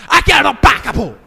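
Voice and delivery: sudden high, loud bark of an order; dictatorial shout. Acted. Dictator lays down iron rule, authoritarian shout. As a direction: lay down the law at a shout. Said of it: "que muitos países são assim, ditatoriais".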